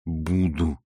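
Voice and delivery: low-key stubborn reply; stubborn Lokhankin. A narrator's reading. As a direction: insist obstinately. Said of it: "упрямо заявил Лоханкин". (упрямо)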